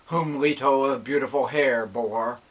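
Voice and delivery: ordinary delivery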